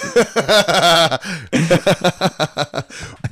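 long high-pitched laugh; real laughter. Real, not acted. Man laughing at his own breakup line, long high laugh. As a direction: burst out laughing at your own jab.

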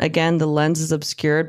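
ordinary delivery

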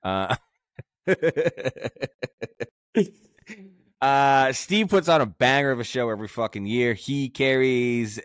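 loud, high hype sponsor read; hype announcer. Acted. Sponsor shout-outs.

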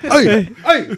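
high, loud, sharp call with a laugh. Shown as a demonstration. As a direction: snap it out like a teacher quieting a room. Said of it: "It's so loud because you can't do it".

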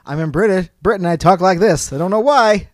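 mock British-ish voice, played for laughs; weird put-on accent. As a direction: ham up a strange half-British accent. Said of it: "It was a weird accent I was choosing there".

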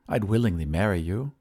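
usual speaking voice

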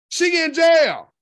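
loud, slow shout. Real, not acted. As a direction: shout it slowly for emphasis. Repeating news, shouting.

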